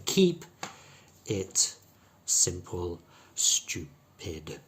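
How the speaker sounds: word by word, long ee in "Keep", short I in "it simple, stupid"; long E then short I. Shown as a demonstration. Teacher breaking the sentence down, short I after Keep. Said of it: "Keep has a long E".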